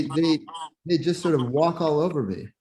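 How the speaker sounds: ordinary talk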